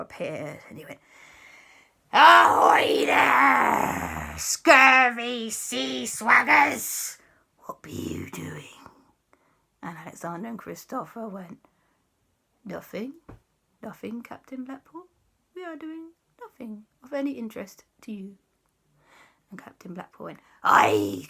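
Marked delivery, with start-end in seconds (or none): 2.1-8.9 drawn-out, slow pirate hail; pirate ghost captain. Acted. Pirate ghost hails the children, slow theatrical menace. 12.6-18.4 soft, evasive little voice; feigned innocence. Acted. Caught children fob off the pirate ghost, softly innocent.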